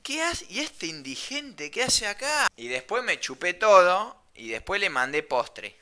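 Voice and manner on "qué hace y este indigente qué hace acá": higher, softer mock snob voice; snooty lady thinking. Posh old lady silently judging the sunburnt stranger, softly mocking. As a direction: sniff at him like a posh lady. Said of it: "puse una cara como diciendo".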